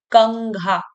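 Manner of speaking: "कंघा" with an ng hum before घा; nasal ng sound. Shown as a demonstration. Teacher demonstrating the ang sound to pupils, ng in "कंघा". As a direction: sound out "कंघा" as kang-gha. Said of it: "यहाँ पे अंग का स्वर आएगा".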